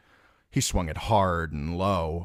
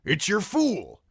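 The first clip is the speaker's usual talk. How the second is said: Crabbe calling out; 14 semitones higher than usual. A narrator's reading.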